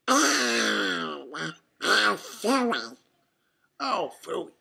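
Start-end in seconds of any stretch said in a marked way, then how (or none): 0.1-3.0 spluttering duck quack; Donald. A narrator's reading. Duck turning round on his shadow, spluttered quack. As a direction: quack it out, garbled and exasperated. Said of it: "Donald quacked as he turned around".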